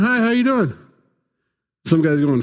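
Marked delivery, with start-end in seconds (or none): 0.0-0.7 high-pitched, sing-out hello; mock casual greeting. Church members greeting each other around town, mock casual high-pitched hello. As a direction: pitch it up like a put-on friendly greeting.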